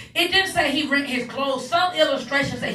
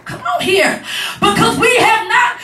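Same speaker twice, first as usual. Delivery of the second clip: raised, loud, driving sermon cadence; higher and louder gospel preaching; preaching at full force; 5 semitones higher, 4 dB louder than usual. Acted. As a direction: preach it, building the fire.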